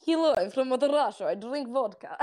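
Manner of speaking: jokey put-on Russian accent, giggle at the end; badly faked Russian accent, amused. Shown as a demonstration. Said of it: "I used to be able to do it".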